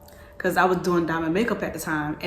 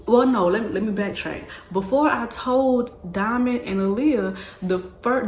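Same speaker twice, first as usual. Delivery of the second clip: ordinary talk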